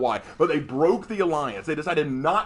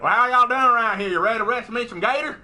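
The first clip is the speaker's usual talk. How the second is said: put-on Cajun accent; 5 semitones higher, 5 dB louder, faster than usual. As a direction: play a hammed-up Bayou cousin in a Cajun accent. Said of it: "his cousin from the Bayou, Gator Knight".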